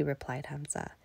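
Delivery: ordinary delivery, nothing marked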